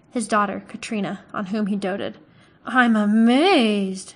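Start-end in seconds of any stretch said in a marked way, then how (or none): 2.6-4.1 drawn-out, louder mock amazement; affected astonishment. A narrator's reading. Butcher sneering at the hunter, in affected astonishment. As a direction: feign being amazed, slowly and loud. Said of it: "said Sloane with affected astonishment".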